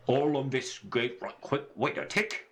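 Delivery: rough go at a Scottish sailor; Scottish accent. Acted. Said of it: "assume he has a Scottish accent".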